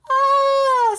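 high, loud startled scream; fisherman's scream. Acted. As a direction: shriek at the sight of the grin. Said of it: "screamed the fisherman".

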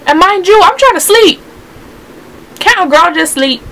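high-pitched, fed-up venting; exasperated complaint. Real, not acted. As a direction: complain with pitch jumping up on the key words. Venting about noise keeping her up.

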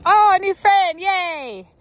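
high, loud, gleeful voicing of the cow; happy cow voice. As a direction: squeal it like a delighted young cow. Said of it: "Danny's like".

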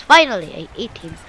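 ordinary talk

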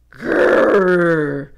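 loud growl; angry growl. A narrator's reading. Brother growling at sister in the hall, loud growl. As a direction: growl like a bear. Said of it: "said Brother".

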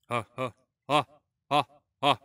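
calling out to test the echo. Shown as a demonstration. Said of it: "Какое эхо!".

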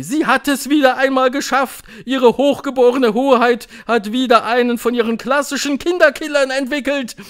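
high, loud cheering witches; hooting crowd. A narrator's reading. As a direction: whoop it out in admiration. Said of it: "johlten sie".

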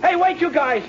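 abandoned man pleading frantically, then a sharp warning cry; panicked calling out. Acted. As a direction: yell in rising panic as they leave you behind. Abandoned in car, calling after robbers.

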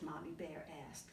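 plain reading voice

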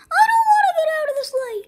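very high, fast whiny refusal; stubborn squeaky Iggy. Acted. Iggy refuses to leave the sleigh.

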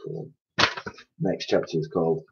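plain reading voice

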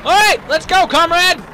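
high, loud rallying yell; excited rallying shout. Pretend. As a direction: shout it like a charge order. Repeats mock Russian comrade rallying call.

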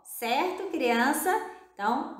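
usual speaking voice

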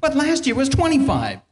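loud, high-pitched objection; mock protest. Taxpayer objecting to the tax man, loud mock protest.

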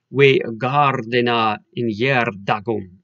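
put-on "We gardena in yer dagum" slowly in Old English pronunciation; Old English recitation. Shown as a demonstration. Showing students the original opening line, slow Old English recitation. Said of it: "this opening line in the original".

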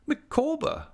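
high, sharp call of the husband's name; a wife calling her husband to order; Mrs Micawber voice. A narrator's reading. Wife correcting husband at table, high and firm.

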